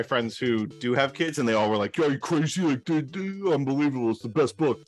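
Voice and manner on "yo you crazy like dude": ordinary delivery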